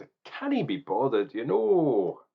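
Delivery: put-on Scottish accent, rising high on the last words; jokey Scottish lilt. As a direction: slip into a playful mock Scottish accent. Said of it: "I was doing a Scottish accent".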